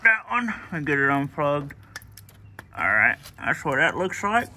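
talking around a flashlight in the mouth. Real, not acted. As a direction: mouth held open by a flashlight. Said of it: "I have my flashlight in my mouth".

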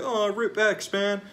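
a hint of a sentimental fan; mocking fan. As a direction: parody a gushing mourner, half-hearted. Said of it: "It's like people that like".

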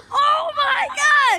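high, slow shocked shout; shocked cry. Real, not acted. Shocked after fall.

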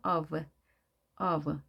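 "of" with a voiced V at the end; F pronounced as V. Shown as a demonstration. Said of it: "F가 V 발음이 됩니다".

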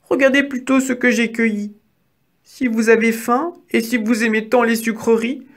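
high mouse voice, bargaining; pleading little mouse. A narrator's reading. Mouse offering sweets to the hungry wolf, high and pleading. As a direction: persuade as a small, high-pitched mouse.

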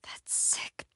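slow hushed whisper; a frightened whisper in the dark. Acted. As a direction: keep it under your breath. Creeping through a horror house.

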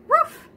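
high, loud yapping woof; dog woof. A narrator's reading. Dog answers Duck with a high loud woof. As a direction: bark it out like a little dog. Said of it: "said Dog".

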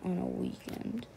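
hushed late-night whisper. Real, not acted. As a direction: whisper so nobody wakes up. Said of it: "why I sound like this".

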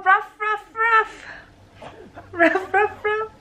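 light mock puppy barks; playful barking. Mom voicing barking dogs in book.

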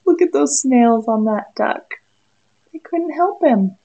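ordinary delivery

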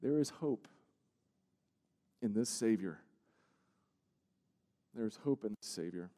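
quiet, sincere comfort after the shouting; soft reassurance. Real, not acted. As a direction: let it settle gently after the storm. Soft assurance after loud climax.

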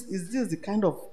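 usual speaking voice

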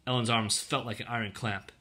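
ordinary delivery, nothing marked

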